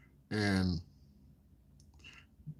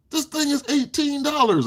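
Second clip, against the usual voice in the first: imitating Bob; 13 semitones higher than usual. Bob voiced quoting price.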